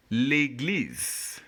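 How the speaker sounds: "L'église" as lay-gleez, last E silent; "L'église" modelled clearly, lay-gleez; French model pronunciation. Shown as a demonstration. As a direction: draw out the final z of "L'église". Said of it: "this is how we pronounce the word".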